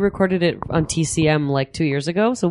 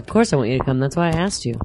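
ordinary delivery

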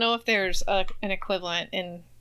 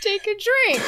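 laughing; 11 semitones higher, 3 dB louder than usual. Real, not acted. Laughing joke.

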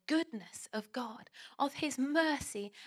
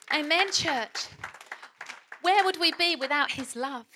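louder, rising preacher's call; preaching at full force; 3 semitones higher, 5 dB louder than usual. Acted. Pastor rallies congregation to praise God, loud preaching. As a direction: stir the church up to praise.

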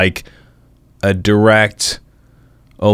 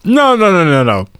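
surprised protest; 11 semitones higher than usual. Real, not acted. Mock protest at being edited out.

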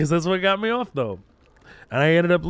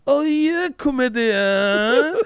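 put-on high, slow drawn-out Japanese accent; realizing, stretched Japanese accent; 11 semitones higher, 4 dB louder, much slower than usual. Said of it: "Japanese accent spot on".

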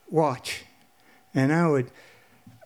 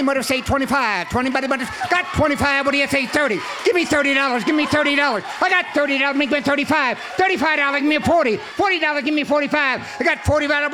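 rapid-fire auction chant calling out bids; auctioneer voice; 3 semitones higher, faster than usual. Acted. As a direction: rattle off bids like a livestock auctioneer. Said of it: "I haven't practiced my auctioneer voice".

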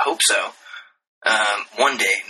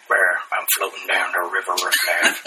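pretend deep Southern voice; 4 semitones lower than usual. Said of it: "I would love to pretend like that was my real voice".

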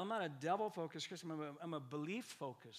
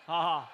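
louder staged ha ha; mock laugh; 12 dB louder than usual. Preacher prompts congregation to laugh at a false belief. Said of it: "Let's laugh at that".

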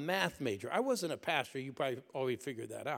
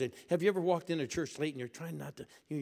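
normal talking voice

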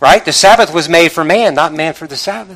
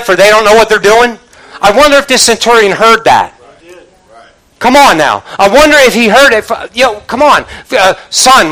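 higher-pitched, forceful pulpit delivery; impassioned preaching; 5 semitones higher, 6 dB louder than usual. Real, not acted. Preacher challenging the congregation about what the centurion saw, impassioned. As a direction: press the questions on the congregation.

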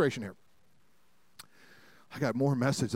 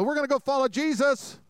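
high, eager put-on fishermen voice; eager disciples; 10 semitones higher, 3 dB louder than usual. Fishermen to Jesus, eagerly dropping everything. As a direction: chirp it like keen young followers.

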